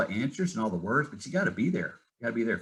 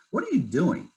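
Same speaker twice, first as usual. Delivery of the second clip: high, insistent retold question; retold exasperated challenge; 9 semitones higher than usual. Wife pressing husband about his life, retold exasperation. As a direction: imitate a fed-up wife, voice jumping high.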